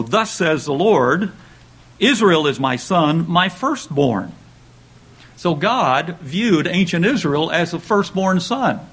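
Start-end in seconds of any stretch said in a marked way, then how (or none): 0.0-4.3 slow, weighty and raised declaration; God's message through Moses to Pharaoh, slow and solemn; solemn proclamation. Acted. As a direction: deliver it like a royal decree.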